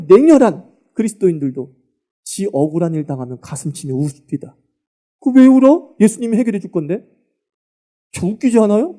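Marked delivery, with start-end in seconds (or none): 5.2-7.1 higher, louder mock challenge; sarcastic why are you crying; mocking question. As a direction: needle them for crying.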